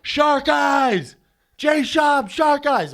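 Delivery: very high, loud crowd holler; fans shouting. Retold or imitated. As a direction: imitate a fan yelling across the arena. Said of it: "someone goes".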